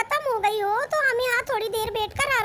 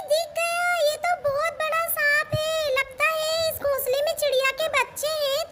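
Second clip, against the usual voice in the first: ordinary delivery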